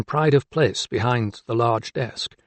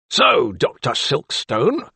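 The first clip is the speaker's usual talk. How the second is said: no-nonsense; 3 semitones higher, slower than usual. A narrator's reading. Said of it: "in a no-nonsense fashion".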